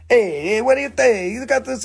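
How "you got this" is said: ordinary delivery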